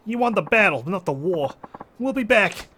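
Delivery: high, loud villain's parting shot; defeated mobster's vow. Acted. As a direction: call it out while retreating.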